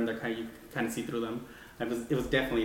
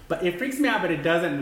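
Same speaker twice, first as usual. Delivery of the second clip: freaked out but laughing it off; 6 semitones higher, 6 dB louder than usual. Real, not acted. Admits the story scares her.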